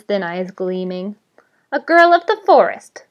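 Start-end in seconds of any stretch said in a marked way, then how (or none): 1.7-3.0 high, loud villainous glee; gloating delight. A narrator's reading. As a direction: pounce on the new victim with relish. Goblin gloats over new victim.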